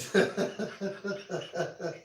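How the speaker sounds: high, rapid chuckle; amused high-pitched laugh. Real, not acted. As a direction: run of high giggly chuckles. Amused by viewer's chat comment.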